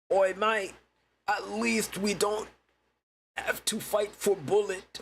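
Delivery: put-on high, slow caricature British accent; exaggerated high-pitched British parody. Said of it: "British accents being like".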